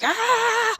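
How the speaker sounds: sharp high mock yelp of a scared dog; a quick high startled cry; imitated panicked yelp. Dog panics during handover.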